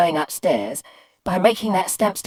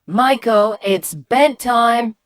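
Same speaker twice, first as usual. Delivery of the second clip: higher, louder, stretched-out bedtime call; insistent mum; 6 semitones higher, 5 dB louder, much slower than usual. Acted. Mum to son ignoring her, insistent call. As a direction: insist to a child who ignores you.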